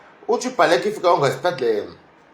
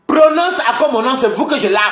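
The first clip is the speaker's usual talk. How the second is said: angry threat; 6 semitones higher, 4 dB louder than usual. Real, not acted. Threatening rivals who mention him.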